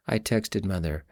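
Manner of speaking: ordinary delivery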